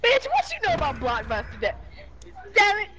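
yelling at someone. Real, not acted. Yelling at Devin in the room.

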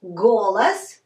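Golas with its second vowel reduced to A; teacher's loud, careful model of GO-las; unstressed O like A. Shown as a demonstration. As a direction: say "Golas" as GO-las, weak A in the last syllable. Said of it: "We pronounce it like A".

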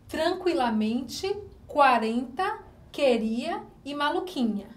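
ordinary talk, nothing marked

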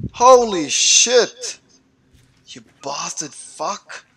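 normal talking voice

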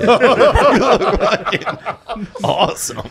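high, loud words through laughter; helpless cracking-up, drawn out; laughing. Real, not acted. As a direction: barely get it out for laughing. Cracking up while roasting.

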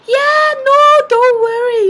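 high, loud mock reassurance; exaggerated cheery soothing, imitating mom; imitated breezy mom. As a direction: wave it away in a high mom voice. Mom breezily reassuring her daughter.

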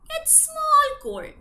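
high and slow for small cold; tiny voice. Acted. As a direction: squeak it small.

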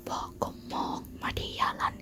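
usual speaking voice

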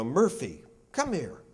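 calling a dog, pitch jumping up on come here. Retold or imitated. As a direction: owner calls the dog over, sing-song call.

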